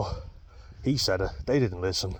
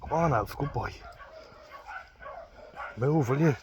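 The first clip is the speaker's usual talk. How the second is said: coaxing the dog; 7 semitones higher than usual. Real, not acted. Coaxing dog Alf along.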